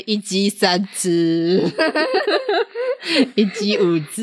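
slow hawking broken by laughter; laughing hawker. Pretend. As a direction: laugh through the sales pitch. Mock vendor laughing.

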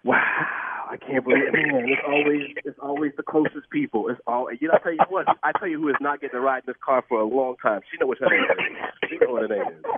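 low, rueful grumble; playful sulk at his wife; mock betrayal. Real, not acted. Pranked husband grumbling about his wife.

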